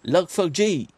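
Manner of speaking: put-on English accent, J stretched as "jay". Shown as a demonstration. Streamer repeating it for the chat before laughing, mock English accent. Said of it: "À l'anglaise".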